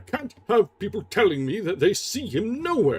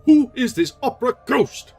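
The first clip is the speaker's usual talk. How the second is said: loud, high snarling demand; angry manager snarling; 10 semitones higher, 9 dB louder than usual. Acted. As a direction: snarl the question at him. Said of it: "he snarled".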